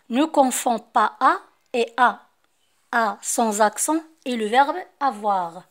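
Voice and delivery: slow, loud dictation to the class; reading the rule aloud. Acted. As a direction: spell out the rule word by word for pupils. Rereads the French rule. (قراءة القاعدة ببطء وبصوت مرتفع للتلاميذ)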